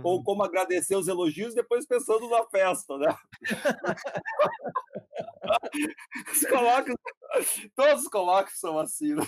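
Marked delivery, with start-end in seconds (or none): 3.4-6.3 long, high-pitched laugh; laughter. Real, not acted. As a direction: keep laughing at your own joke. Laughs after joke about conferences.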